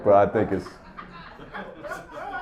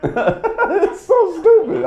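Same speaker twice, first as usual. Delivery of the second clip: much higher and very slow, laughing; much slower than usual. Real, not acted. Laughing at the whole fire brigade visit, high and slow. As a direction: stretch it out while cracking up. Cracking up about the firefighters.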